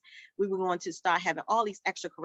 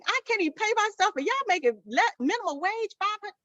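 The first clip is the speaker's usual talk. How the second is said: much higher, worked-up protest; indignant; 12 semitones higher than usual. Real, not acted. Owner fuming about unpaid wages, high indignant rush. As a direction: let it rise in outrage.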